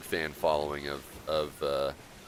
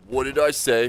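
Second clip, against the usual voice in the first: mock indignant; 6 semitones higher, 7 dB louder than usual. Real, not acted. Teasing friend about claim.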